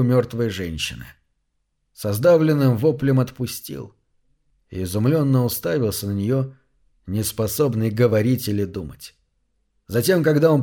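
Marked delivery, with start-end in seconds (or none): none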